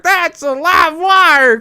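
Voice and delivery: high, loud, drawn-out cartoonish holler; mock shocked yelp. Podcaster imitating the man shocked by the tent, hammed-up yell. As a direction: yell it slowly in a hammed-up character voice. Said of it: "and he's like".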